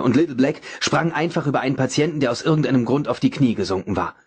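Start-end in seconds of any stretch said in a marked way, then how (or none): none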